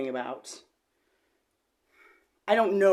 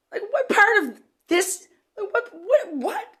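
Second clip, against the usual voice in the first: incredulous; 6 dB louder, slower than usual. Real, not acted. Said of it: "disbelief at therapist".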